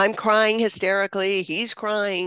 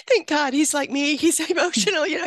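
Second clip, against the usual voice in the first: "Thank God" laughed out much higher; laughing with relief; 7 semitones higher than usual. Real, not acted. Mother relieved her son is emotional too, laughing. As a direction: laugh through it with real relief.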